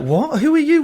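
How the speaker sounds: jumps high in disbelief; mock shocked "who are you"; baffled stranger. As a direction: play the startled stranger in the elevator. Stranger baffled by ASMR pitch.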